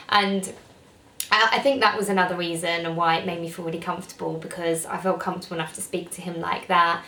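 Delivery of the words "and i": ordinary talk, nothing marked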